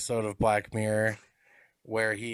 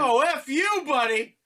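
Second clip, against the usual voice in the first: higher, louder teasing repeat; mock echo; 12 semitones higher, 7 dB louder, slower than usual. Cohost teasing the speaker over the acronym, higher louder mockery. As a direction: toss the acronym back with a grin.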